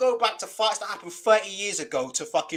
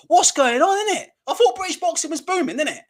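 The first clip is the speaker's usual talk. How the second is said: loud, much higher exclaiming; mock outrage; 9 semitones higher, 6 dB louder than usual. Real, not acted. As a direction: rant in disbelief. Mock outrage over boxing.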